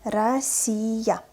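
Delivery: "Россия" stretched syllable by syllable; slow, articulated reading. Shown as a demonstration. Teacher models for learners, "Россия" read slowly. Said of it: "what is articulated pronunciation".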